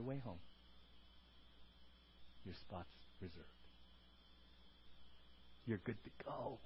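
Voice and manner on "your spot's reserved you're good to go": very soft, low preacher's hush; quiet, low assurance; hushed reassurance. Real, not acted. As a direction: drop right down almost to a whisper. Hushed aside about dying and heaven.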